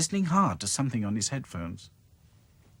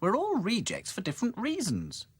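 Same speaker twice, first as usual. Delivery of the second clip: put-on a higher, brisk girl doll; bright doll explaining; Dotty voice; 11 semitones higher than usual. Dotty explains to Sad Sack in a higher voice. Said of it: "explained Dotty".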